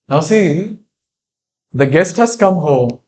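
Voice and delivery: usual speaking voice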